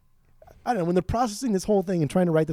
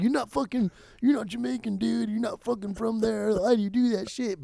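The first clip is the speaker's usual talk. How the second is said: higher, mocking scold at the singer; mocking rant at singer; 7 semitones higher than usual. Fan mocking the singer's fake Jamaican accent, higher scolding. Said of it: "That, that stupid accent that he".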